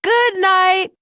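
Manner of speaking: high, yelled toy voice; Louie. A narrator's reading. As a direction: yell it out high, like an excited toy. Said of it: "Louie yells".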